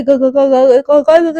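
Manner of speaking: too scared to get a word out; stammering in fear. Acted. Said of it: "word ही नहीं निकल रहे".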